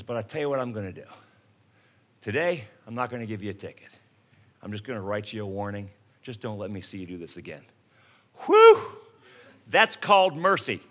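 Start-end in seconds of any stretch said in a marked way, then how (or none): 0.0-7.6 lower, softer, fast and lenient; softened cop. Retold or imitated. Cop letting the speeder off, softer and lower. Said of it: "he softens up". 8.5-8.8 loud high whoosh of relief; relieved whew. Real, not acted. Speaker relieved after the warning, loud high whew.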